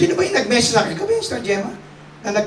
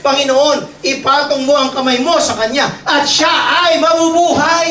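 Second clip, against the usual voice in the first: pleading prayer; 5 semitones higher, 4 dB louder than usual. Retold or imitated. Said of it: "siya mismo ang nagsabi".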